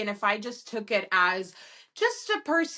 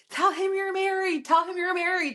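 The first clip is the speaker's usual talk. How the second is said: higher, urgent mock yell at the phone; retold yelling; 8 semitones higher than usual. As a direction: urge her on like shouting at a screen. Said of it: "I kept screaming at my phone".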